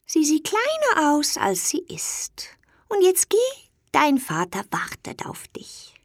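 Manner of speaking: put-on a secretive, whispery old toad, kindly; old toad whispering. A narrator's reading. Old toad hands Dummerchen the magic box, whispering. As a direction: hush it like a wise old toad sharing a secret.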